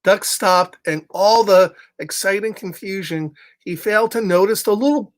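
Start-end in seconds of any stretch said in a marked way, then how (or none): none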